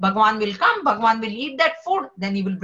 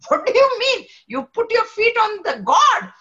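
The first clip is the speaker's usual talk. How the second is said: high, louder, scandalised rebuke; angry outburst; 10 semitones higher, 4 dB louder than usual. Retold or imitated. Namdev scolding the cobbler with feet on Shivling, high angry rebuke. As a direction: scold the old man for disrespect. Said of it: "Now Namdev again gets angry".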